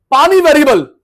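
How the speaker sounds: पानी thrown out loud, then variable falls; loud emphatic call. Shown as a demonstration. Teacher drilling students on variable costs, loud call. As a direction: shout the word पानी high and loud to the class. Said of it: "भाई, आई contact नहीं टूटेगा".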